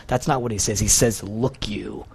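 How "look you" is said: low, quiet and contemptuous, LOOK clipped; insulting tone. Retold or imitated. Elder son to his father, low contemptuous put-down. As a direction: talk down with scorn. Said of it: "That's, that's the tone that he's talking to his dad".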